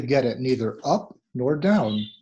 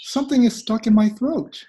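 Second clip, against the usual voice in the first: higher, brighter normal-speed take; lively; 9 semitones higher, 3 dB louder than usual. Shown as a demonstration. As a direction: say it briskly with a lift. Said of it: "lively teaching take".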